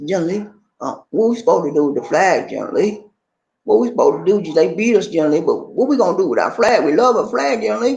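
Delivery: high, pleading soldier's voice, rising in distress; begging soldier. Retold or imitated. A defeated soldier begs General Lee about the flag, high and pleading. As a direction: beg your general like a frightened boy.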